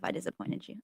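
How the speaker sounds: guilty little whisper. Pretend. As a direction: breathe it out like a confession. Apologizing to her higher self.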